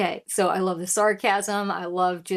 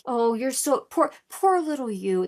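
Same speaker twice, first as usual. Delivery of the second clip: higher, slowed mock pity; Southern bless-your-heart condescension; sarcastic; 5 semitones higher, 3 dB louder than usual. Reactor paraphrasing the rapper's jab, sarcastic sympathy. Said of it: "That sarcasm, that's, that's very Southern, bless your heart".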